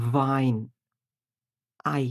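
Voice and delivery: ordinary delivery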